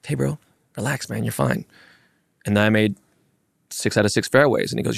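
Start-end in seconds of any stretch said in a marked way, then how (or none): 0.0-1.6 softer, easygoing imitation of a calming partner; retold reassurance. Star player calms his nervous partner, soft retold reassurance. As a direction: drop the volume and settle them down. Retells golfer calming him on fairway.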